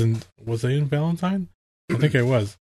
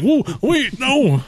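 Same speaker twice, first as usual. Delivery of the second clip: startled whoa; 10 semitones higher, slower than usual. Retold or imitated. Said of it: "And I'm like".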